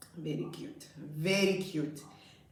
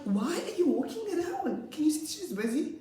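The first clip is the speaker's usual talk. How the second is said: high, exasperated telling-off with a laugh; amused, high-pitched scolding; exasperated teacher; 10 semitones higher, 4 dB louder than usual. Retold or imitated. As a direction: scold a wandering pupil in mock despair.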